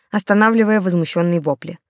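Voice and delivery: ordinary delivery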